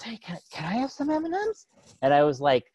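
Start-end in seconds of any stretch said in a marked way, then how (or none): 0.0-1.7 put-on high, far-off small boy voice, hopeful; little kid asking. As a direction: ask for candy like a sweet five-year-old. Five-year-old asking for M&Ms.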